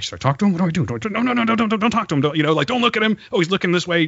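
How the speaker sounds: high, rushed fanboy panic; mock-frantic self-talk beside the star; retold starstruck panic. Fretting at the restaurant table about talking to his hero, mock panic. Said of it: "I'm like".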